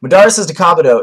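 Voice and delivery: ordinary talk, nothing marked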